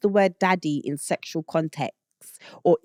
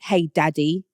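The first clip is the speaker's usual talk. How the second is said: British accent. Shown as a demonstration. Showing it sounds bad at home, British accent. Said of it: "It does not sound good in a British accent".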